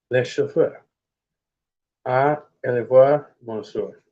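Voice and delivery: slow, halting French; sounding out the French line; attempted French. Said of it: "I fucked that one up bad".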